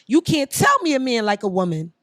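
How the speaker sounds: usual speaking voice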